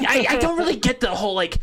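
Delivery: high YouTuber narrator voice; Scott the Woz voice. Retold or imitated. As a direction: do a high, wry YouTuber impression. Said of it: "this is just how Scott the Woz speaks".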